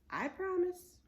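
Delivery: put-on high, eager little girl promise. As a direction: promise brightly like a kid. Annie high reply.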